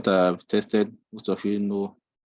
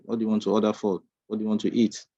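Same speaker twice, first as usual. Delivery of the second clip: ordinary talk, nothing marked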